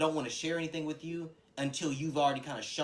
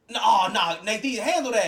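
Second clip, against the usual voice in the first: loud, higher hype-up; egging on; 7 semitones higher, 11 dB louder than usual. Retold or imitated. Friend hyping Nathan to handle it, loud egging. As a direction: push him into it.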